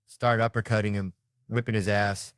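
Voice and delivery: ordinary delivery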